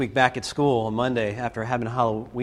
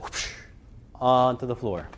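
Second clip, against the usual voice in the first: ordinary talk, nothing marked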